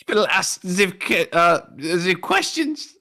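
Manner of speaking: put-on German accent. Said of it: "Can you yell, in a German accent".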